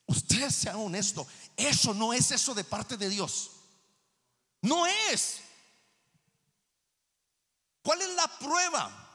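high, insistent challenge to the congregation; forceful preaching. Real, not acted. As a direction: press them hard from the pulpit.

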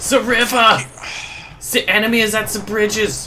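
put-on German accent. Soldier reporting enemy at the bridges, German accent. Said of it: "I only have a good German accent".

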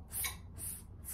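hissed "S, S, S" unvoiced like a snake; s sound. Shown as a demonstration. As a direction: stretch each "S" into a hiss. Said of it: "S is for snowman".

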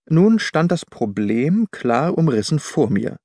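ordinary delivery, nothing marked

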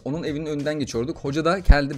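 ordinary talk, nothing marked

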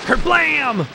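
booming sound effect; loud thunder kerblam. Acted. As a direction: shout it like thunder.

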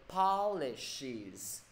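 "Polishes" drawn out in three syllables; broken down slowly. Shown as a demonstration. Teacher checking students keep up, slow syllable breakdown. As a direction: spell out "Polishes" by sound, syllable by syllable. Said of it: "Kịp nha mọi người?".

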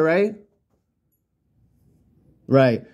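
ordinary talk, nothing marked

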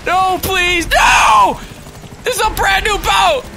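1 mock panic. Boat attacked by helicopter.

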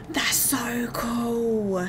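slow, hushed whisper of wonder; awed whisper. Real, not acted. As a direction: breathe it out in awe. Whispering in awe after a gasp.

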